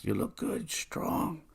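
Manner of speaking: high, thin imitation of a grandfather; frail proud grandfather. Ailing grandfather praises grandson, high frail voice. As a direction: imitate an old man praising you, high and nearly whispered.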